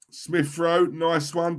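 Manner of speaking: usual speaking voice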